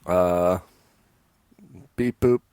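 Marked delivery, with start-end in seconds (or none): 1.9-2.4 lightly voiced robot beeps. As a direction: say beep boop like a robot. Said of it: "Try again, Data".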